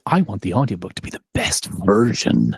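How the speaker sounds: a touch of lofty arrogance; selfishly arrogant. Acted. Narrator confessing her ambition for the audiobook, mildly arrogant. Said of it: "maybe selfishly and arrogantly".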